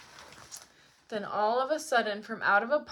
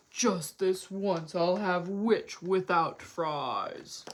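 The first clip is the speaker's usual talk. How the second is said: slow, lower villain voice; gloating dragon; 5 semitones lower, slower than usual. A narrator's reading. Dragon closing in on the witch, slow and gloating. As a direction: savour each word menacingly.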